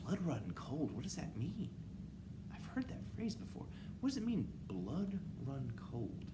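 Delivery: softly puzzled inner thought; thinking voice. Acted. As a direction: wonder to yourself, half whispered. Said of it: "Let's switch to thinking voice".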